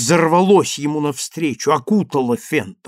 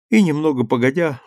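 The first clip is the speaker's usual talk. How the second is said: ordinary delivery, nothing marked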